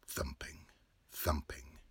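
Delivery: breathy machine thump whispered. A narrator's reading. Narrator mimics the press in a whisper.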